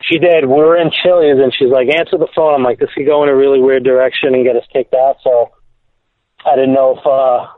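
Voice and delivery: distant caller on speakerphone; phone voice. Real, not acted. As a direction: talk from a car through a phone.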